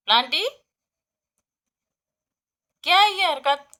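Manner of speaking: very loud, high telling-off; loud scolding. Real, not acted. Owner scolding Planty for eating dirt, very loud high scold. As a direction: scold the naughty bunny.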